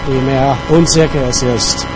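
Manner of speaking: ordinary delivery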